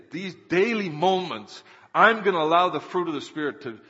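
loud, emphatic preacher's resolve; impassioned preaching. Real, not acted. Preacher voicing a believer's daily choice, impassioned preaching. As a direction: declare it firmly from the pulpit.

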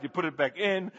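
normal talking voice

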